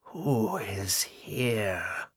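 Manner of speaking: slow, hollow and cold as a tomb; hollow ghostly whisper. A narrator's reading. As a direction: breathe it out like wind through a tomb. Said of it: "Agatha whispered".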